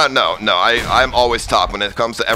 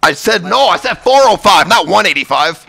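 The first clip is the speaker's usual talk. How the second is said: loud; 6 semitones higher, 6 dB louder than usual. Real, not acted. Correcting friend loudly.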